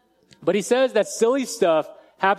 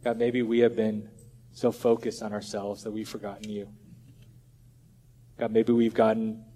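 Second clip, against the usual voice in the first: quiet prayer; 5 semitones lower, 4 dB quieter than usual. Acted. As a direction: pray humbly to God, unhurried and hushed. Closing prayer.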